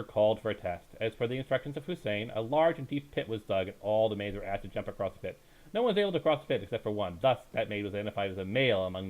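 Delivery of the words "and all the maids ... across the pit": ordinary delivery, nothing marked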